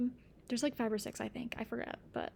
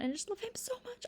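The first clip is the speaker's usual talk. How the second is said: gushing; 10 semitones higher, 5 dB quieter than usual. Real, not acted. Gushing about a favourite character.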